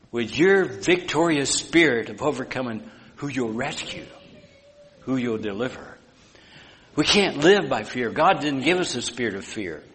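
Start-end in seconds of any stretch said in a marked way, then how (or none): none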